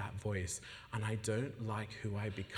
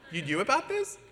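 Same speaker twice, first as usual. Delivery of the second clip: surprised; 11 semitones higher, 8 dB louder than usual. Retold or imitated. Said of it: "I was like".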